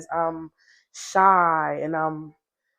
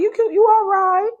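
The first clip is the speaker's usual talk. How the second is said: much higher, coy; shy flirty self; 13 semitones higher, 4 dB louder than usual. Retold or imitated. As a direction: play it coy and high. Repeated flirting line.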